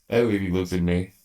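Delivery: ordinary delivery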